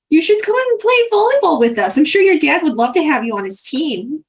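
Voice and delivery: high, quick, coaxing lilt; gushing busybody. Acted. Greta urges Bronwyn to join volleyball, coaxing. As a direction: coax brightly from high pitch, then settle.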